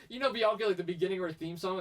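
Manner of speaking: normal talking voice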